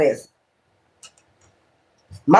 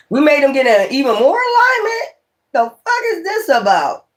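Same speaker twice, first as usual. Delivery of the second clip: incredulous outburst; 8 semitones higher than usual. Real, not acted. Outraged at the cult's actions.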